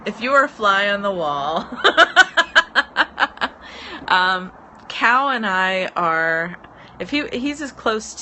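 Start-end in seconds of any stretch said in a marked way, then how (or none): 1.7-4.0 loud, high laughter. Real, not acted. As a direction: burst out laughing. Laughing at viewer comment.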